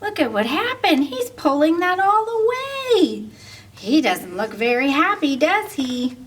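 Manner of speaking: usual speaking voice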